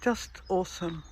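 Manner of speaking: ordinary delivery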